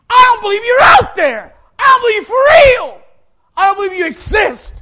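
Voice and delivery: high, loud shouted accusation; shouting at the cross, feeling let down by God; furious challenge to God. Retold or imitated. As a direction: rage at God for betraying you. Yelling his challenge at God.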